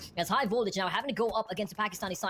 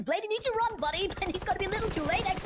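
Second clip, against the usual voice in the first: excited caster; 10 semitones higher than usual. Real, not acted. Caster urges player, laughing.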